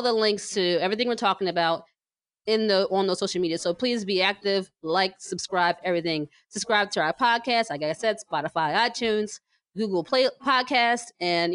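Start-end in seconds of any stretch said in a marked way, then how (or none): none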